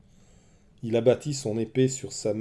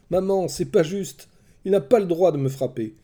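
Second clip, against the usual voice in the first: put-on high, loud whiny little boy; a seven-year-old protesting it's unfair; child's voice; 8 semitones higher, 6 dB louder than usual. A narrator's reading. Young Oreste complaining to his mother, high whining child.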